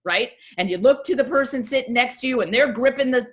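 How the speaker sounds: usual speaking voice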